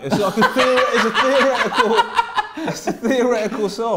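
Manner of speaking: high, loud, laughing through the words; laughing protest. Real, not acted. Defending a song for an unsigned player, laughing protest. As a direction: protest while cracking up.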